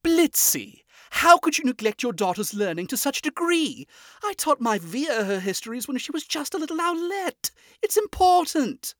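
lofty prince scolding his husband; accusing. A narrator's reading. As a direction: scold him in a lofty high voice. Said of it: "with an accusing tone".

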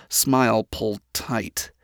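usual speaking voice

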